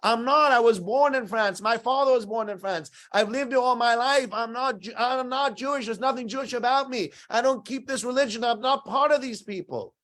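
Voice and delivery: desperate protest. Retold or imitated. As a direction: plead your innocence to them. Victim protesting to persecutors.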